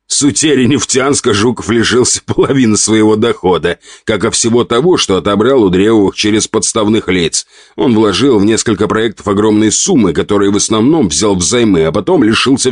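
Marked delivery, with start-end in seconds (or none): none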